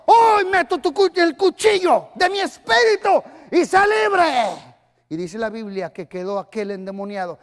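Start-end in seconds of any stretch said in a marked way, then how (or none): 0.1-4.7 commanding shout. Retold or imitated. Pastor shouting as Jesus frees the demoniac, commanding.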